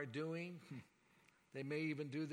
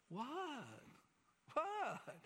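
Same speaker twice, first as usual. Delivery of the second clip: mock bewildered double take, second one much higher; baffled what; 9 semitones higher than usual. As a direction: play the shocked, confused target of anger. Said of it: "And you're going like".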